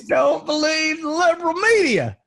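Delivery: high, laughing put-on conspiracy voice; mocking voice. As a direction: ham up the liberal media warning. Repeats joke in put-on voice.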